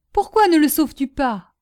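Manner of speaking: high, loud reproachful question; desperate demand. A narrator's reading. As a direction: press him hard for an answer. Woman demands he save lover. (question criée, aiguë, désespérée)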